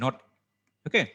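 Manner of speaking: ordinary talk, nothing marked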